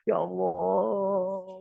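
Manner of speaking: very slow, drawn-out pleading cry; earnest whimper. Shown as a demonstration. Believer whimpers to God like a baby, slow plea. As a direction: stretch it out like a crying child. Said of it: "kita merengek pada, pada Allah".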